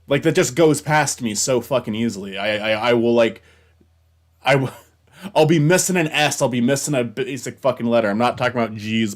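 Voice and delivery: loud exasperated venting; frustrated rant. Real, not acted. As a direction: keep venting at yourself. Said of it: "infuriates me".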